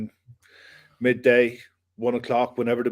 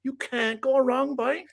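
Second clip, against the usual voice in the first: mock Irish Cork accent, pitched up and lilting; playful Cork accent sponsor read mocking a friend's request; 10 semitones higher than usual. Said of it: "I should be doing the I P Vanish in the Cork accent".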